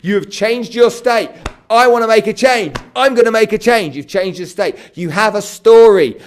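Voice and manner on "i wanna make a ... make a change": loud, high and emphatic; fired-up declaration. Acted. Coach voicing a client's new resolve, fired-up declaration. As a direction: declare it with full conviction. Models a state-change declaration.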